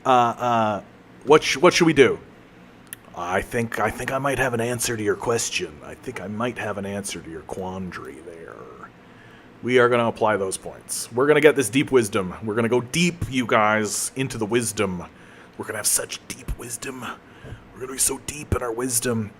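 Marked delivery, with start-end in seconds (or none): 3.1-8.3 a hint of a smug trickster, lower and softer; lightly voiced sly Q. Voicing Q offering the answer, a quiet sly hint. 15.5-19.3 softer, hushed mock-profound voice. Mock deep wisdom talk.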